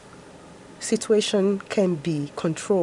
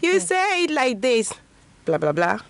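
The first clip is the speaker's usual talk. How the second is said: lightly voiced toddler. Two-year-old telling mommy how to say it, lightly imitated. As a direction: lightly play a two-year-old putting mom right. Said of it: "a two-year-old is telling you".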